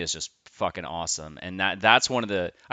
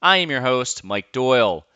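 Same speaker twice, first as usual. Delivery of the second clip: higher, louder host welcome; podcast intro; 8 semitones higher, 7 dB louder than usual. Acted. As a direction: announce the show to open the episode.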